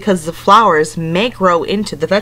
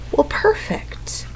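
soft, slow whispered aside; 10 semitones higher, 6 dB quieter, slower than usual. Acted. Storytime teacher confiding to children, slow whisper. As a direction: lean in and whisper it.

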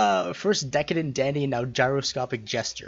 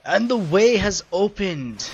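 higher, louder, slow proclamation; grand announcement; 7 semitones higher, 7 dB louder, slower than usual. Acted. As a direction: declare it like an epic herald.

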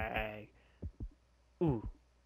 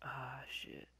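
whispered curse; 4 semitones lower, 10 dB quieter than usual. Real, not acted. Quiet frustrated curse.

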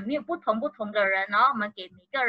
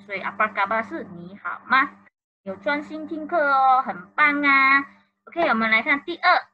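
normal talking voice

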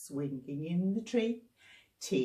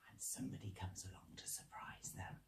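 secretive fast whisper; 13 semitones lower, 19 dB quieter, faster than usual. Shown as a demonstration. Leader whispering to little children, building suspense. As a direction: hush it like a secret. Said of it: "unvoiced whisper".

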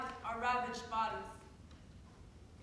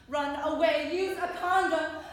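loud, insistent recital; raised voice, pressing and desperate; urgent outburst; 3 semitones higher, 9 dB louder than usual. Acted. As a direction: throw each phrase out forcefully. Forbidden acts burst out in poem.

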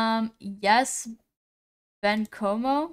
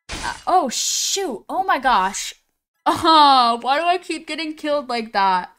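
loud, higher groan of frustration; exasperated outburst; 3 semitones higher, 6 dB louder than usual. Real, not acted. Streamer killed in the game, loudly exasperated. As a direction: wail at being killed yet again.

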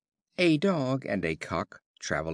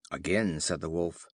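usual speaking voice

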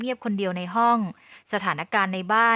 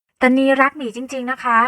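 higher, louder, slow insistence; insistent; 5 semitones higher, 8 dB louder than usual. A narrator's reading. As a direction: hold your ground pleading your love. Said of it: "นียังคงยืนยันเสียงแข็ง". (ยืนยันเสียงแข็ง)

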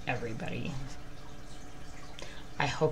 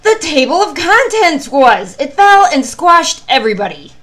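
rising storybook climax; dramatic reveal; 10 semitones higher, 16 dB louder than usual. A narrator's reading. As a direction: land the twist with big drama. Dramatic twist ending of story.

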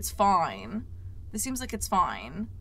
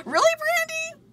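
surprised squeal; 6 dB louder than usual. Real, not acted. Squeal at a friend's name.

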